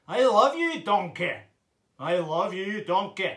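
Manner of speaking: very loud ogre impression; Shrek. Shown as a demonstration. Ogre declaring love to donkey, loud belly bellow. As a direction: bellow it from the belly with a put-on accent. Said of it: "Can you do a Shrek impression?".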